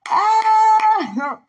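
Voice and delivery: loud, very high drawn-out cry; real overwhelmed squeal; long high squeal. Real, not acted. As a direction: let out a long, high wail. Overcome, cries out.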